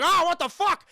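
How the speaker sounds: loud, high mock cry of shock; hammed-up horrified exclamation; shocked outburst. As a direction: act out startled onlookers, yelling. Onlookers shocked by the body.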